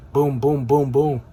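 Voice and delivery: ordinary talk, nothing marked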